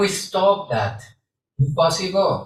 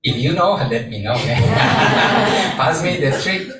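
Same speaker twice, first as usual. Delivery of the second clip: ordinary talk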